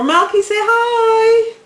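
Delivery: high, drawn-out coaxing call. Real, not acted. Calling Malky to say hi on camera, high coaxing. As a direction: coax him loudly in a slow, high sing-song.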